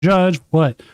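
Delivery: urgent call. Acted. Acting player calling the judge.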